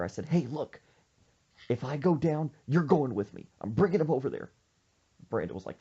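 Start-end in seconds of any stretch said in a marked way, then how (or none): 0.3-4.5 insistent. Retold or imitated. Insisting friend shares the burden.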